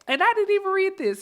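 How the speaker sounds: shrill mock-outraged squeak; high squeaky mock voice. To the book, a high squeaky mock protest.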